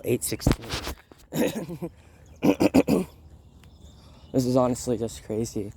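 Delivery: ordinary delivery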